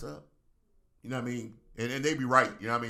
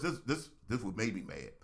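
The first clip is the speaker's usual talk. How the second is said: ordinary delivery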